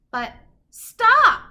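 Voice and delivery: sudden high, loud halt; emphatic storyteller's stop; dramatic stop. A narrator's reading. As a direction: slam on the brakes with the voice. Dramatic halt in read-aloud.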